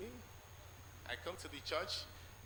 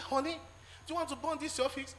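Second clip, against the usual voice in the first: high, fast imitation of the worried wife; imitating wife; 11 semitones higher, faster than usual. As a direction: make the wife's question high and quick. Wife asks about petrol.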